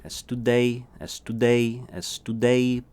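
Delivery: estudei repeated, es-tu-DEI stress on the end; estudei, final DEI stressed; drilled estudei. Shown as a demonstration. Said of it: "Kinda like that".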